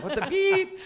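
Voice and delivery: very high, laughing mock outburst with beep; self-censored yell. [name] retelling his store tantrum, laughing censored yell. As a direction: redo the rant, swapping the curse for beep. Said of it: "Sorry for cursing".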